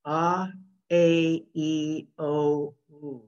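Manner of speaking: "A, E, O, U" as pure open vowels, each set apart; Japanese vowels demo. Shown as a demonstration. Teacher showing the standard vowel sounds to the class. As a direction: model the vowels cleanly, one at a time. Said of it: "a standard way to pronounce those vowels".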